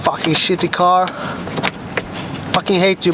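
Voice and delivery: usual speaking voice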